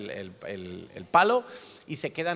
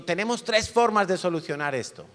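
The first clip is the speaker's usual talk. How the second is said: higher, louder drill-sergeant speech; a bit of a sergeant; 5 semitones higher, 5 dB louder than usual. Retold or imitated. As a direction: lay down the law like a camp sergeant. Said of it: "como un poquito sargento".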